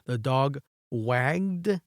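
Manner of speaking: very slow, word by word; slow example sentence. Shown as a demonstration. Teacher reading an example to students, very slow. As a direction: spell the sentence out slowly. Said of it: "cuando está meciendo la colita".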